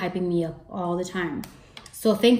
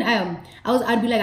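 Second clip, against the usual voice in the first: ordinary talk, nothing marked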